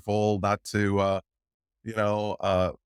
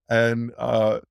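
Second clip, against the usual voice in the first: ordinary talk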